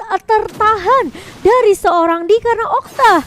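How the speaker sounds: ordinary delivery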